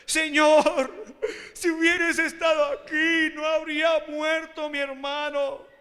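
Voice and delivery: high, loud mock wail; acted tearful lament. María at Jesus's feet over her dead brother, acted wailing. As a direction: cry out as a grieving sister, slowing at the end. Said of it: "al verla llorando".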